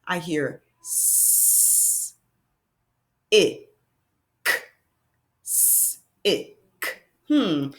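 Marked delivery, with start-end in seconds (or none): 0.8-4.5 "s, I, k" as separate sounds: long hiss, short ih, bare k; sounding out. Shown as a demonstration. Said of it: "let's think about the sounds". 5.4-6.9 "s, I, k" split into hissed s, short ih, clicked k; sounding out. Shown as a demonstration. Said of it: "let's think about the sounds".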